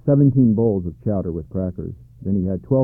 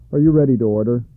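a louder, brisk waiter's question; waiter moose; 3 semitones higher, 6 dB louder, faster than usual. A narrator's reading. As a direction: serve the townsfolk like a head waiter.

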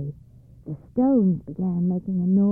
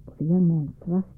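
plain reading voice

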